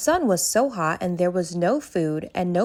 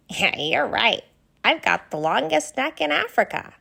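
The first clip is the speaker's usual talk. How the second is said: much higher, curious giraffe voice; a kind, worried young giraffe asking questions; Ginger the giraffe; 6 semitones higher than usual. Acted. Ginger laughs along.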